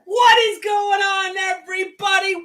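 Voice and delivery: loud, stretched-out show opener; hyped host greeting. Real, not acted. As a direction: kick off the show with a big shout. Host opens the live stream.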